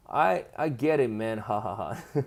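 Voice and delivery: usual speaking voice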